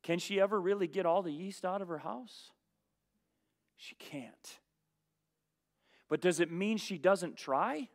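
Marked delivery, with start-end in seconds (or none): 3.8-4.6 breathy whisper, almost silent; hushed stage whisper to the room; whispered answer. Acted. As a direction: drop to a whisper for the punchline. Rhetorical answer to congregation.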